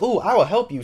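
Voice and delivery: high, swooping playful "ooh"; mock-eager enthusiasm; eager offer. Aqua eagerly offers to help.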